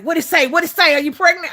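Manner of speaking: imitating eager friends, high and loud; excited questioning. Friends demanding her test result, excited questioning. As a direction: pepper them with urgent, excited questions.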